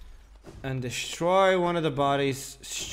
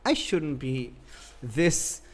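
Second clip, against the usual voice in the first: frustrated outburst; 7 dB louder than usual. Real, not acted. Frustrated at boss fight.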